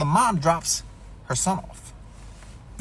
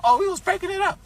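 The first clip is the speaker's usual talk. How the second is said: high, whiny mock excuse; mocking friends' excuse; 4 dB louder than usual. Narrator mocking friends who didn't help, whiny mock voice. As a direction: mimic their lame excuse with scorn. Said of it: "They talking about".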